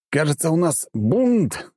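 slow, high and sour; grimacing. A narrator's reading. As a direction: drag it out with a wry grimace. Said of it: "скривился я".

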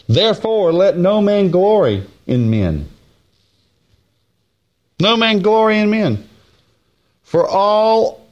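high, slow proclamation of scripture; drawn-out pulpit call, lifting the words; preaching. Acted. Preacher proclaiming the verse to the church, slow and ringing.

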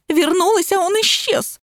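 high-pitched mournful whine; moaning. A narrator's reading. Lapulya returning to find him gone, high moaning wail. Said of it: "застонала Лапуля".

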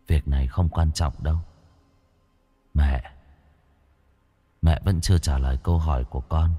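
put-on low, soft ghost-child voice; ghostly son. A narrator's reading. Dead son greeting his mother, low and hushed. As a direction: speak low and hushed like a returning spirit.

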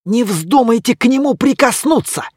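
high, loud sharp warning; urgent warning. A narrator's reading. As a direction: stop them touching it. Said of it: "предупредил Гордон Бёрн".

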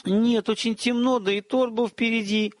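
tentative female reply; unsure. A narrator's reading. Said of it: "неуверенно ответила Веретта".

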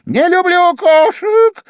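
very high, loud, drawn-out squeak; piercing mouse squeal. A narrator's reading. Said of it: "пронзительно пропищала мышь". (пронзительный мышиный писк)

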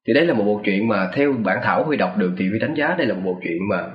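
usual speaking voice

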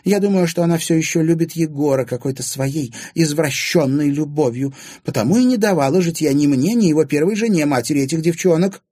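high, loud, indignant woman's voice; Anfisa, blunt accuser. A narrator's reading. Anfisa accusing her husband's former love, indignant. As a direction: pour out the accusation, high and forceful.

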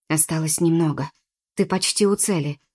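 ordinary delivery, nothing marked

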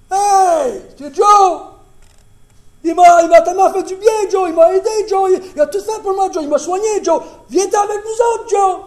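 high, loud, overjoyed shouting; excited calling out. Acted. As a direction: yell across heaven to welcome a friend.

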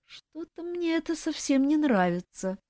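high, whiny moan. A narrator's reading. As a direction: moan it out in dismay. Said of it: "простонал зайчонок".

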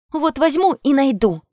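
a touch of spite, pitched high; spiteful retort. A narrator's reading. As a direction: snap back defiantly. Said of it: "злобно буркнула Настя".

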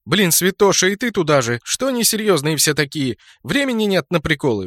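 loud, high, exasperated; indignant. Acted. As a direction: scold them, fed up. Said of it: "возмутилась Орчиха".

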